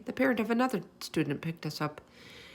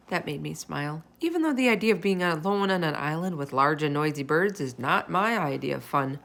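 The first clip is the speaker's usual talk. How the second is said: usual speaking voice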